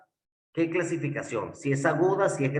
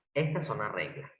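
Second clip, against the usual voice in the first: "Estas son las reglas" repeated lower and softer, sinking away; 5 semitones lower, 6 dB quieter than usual. Shown as a demonstration. Teacher repeating the rules to the class, softer and lower. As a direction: let the rule settle, say it again quietly.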